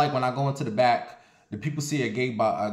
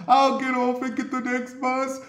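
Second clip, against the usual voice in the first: much higher put-on voice for the man; imitating the coughing guy; 11 semitones higher than usual. Coughing man apologizing to the bus, higher mock voice.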